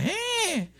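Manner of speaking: high, short fake cry of a child; mock toddler whine. Toddler begging to be held, high mock whine. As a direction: imitate a kid whining to be picked up. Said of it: "lift up their hands and cry".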